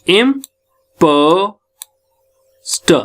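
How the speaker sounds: Imposed very slow, with पो held long; drawn-out syllables showing stress in imposed. Shown as a demonstration. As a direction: stretch the stressed पो and set the last syllable apart. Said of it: "तो प पे हमारा स्टस पड़ रहा है".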